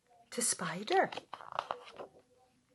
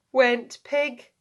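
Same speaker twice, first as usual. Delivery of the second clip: usual speaking voice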